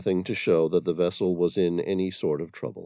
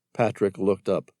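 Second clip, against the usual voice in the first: ordinary delivery, nothing marked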